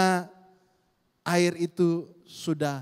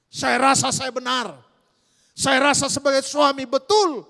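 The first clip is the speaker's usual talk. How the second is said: self-righteous; 8 semitones higher, 8 dB louder than usual. Retold or imitated. Mock self-righteous husband.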